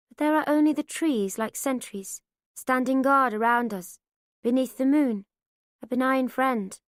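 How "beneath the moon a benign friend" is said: usual speaking voice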